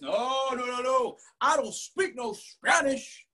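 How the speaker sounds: high-pitched refusal, slowing at the end; string out the denials high, then drag the last line; protesting student. Retold or imitated. Student refusing his Spanish name, high drawling protest.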